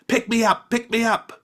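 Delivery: small goblin pleading to be carried; begging goblin. Acted. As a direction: beg like an eager little goblin child.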